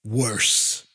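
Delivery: ordinary delivery, nothing marked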